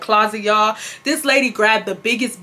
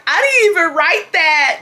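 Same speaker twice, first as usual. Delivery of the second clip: lying denial; 7 semitones higher, 4 dB louder than usual. Retold or imitated. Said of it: "why I start lying".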